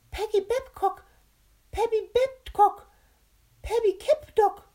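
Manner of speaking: very high, loud squeaky character voice; tongue-twister attempt. A narrator's reading. Captain Carr attempts the mouth's tongue twister, high-pitched and tripping up. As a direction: force out each try, stumbling on the last. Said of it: "leckte sich die Lippen und ratterte los".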